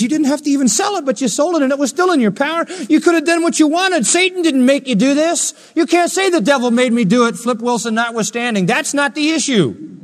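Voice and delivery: very high, loud, relentless preaching; full-force preached rebuke. Acted. Preacher voicing a rebuke to Ananias, full-force preaching. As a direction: thunder the accusation from the pulpit.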